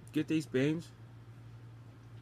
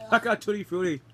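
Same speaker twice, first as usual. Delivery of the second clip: laughing with relief; 7 semitones higher, 9 dB louder than usual. Real, not acted. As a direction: laugh through the words, high and loud. Laughing relief after tasting.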